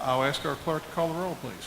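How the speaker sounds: ordinary delivery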